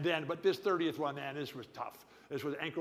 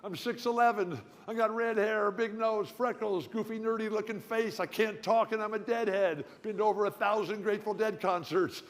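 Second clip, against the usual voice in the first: high, louder burst of inspiration; eureka moment; 6 semitones higher, 5 dB louder than usual. Retold or imitated. Stuttering ex-player excitedly pitching himself for television. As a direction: sell the idea to yourself with glee. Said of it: "And I just go".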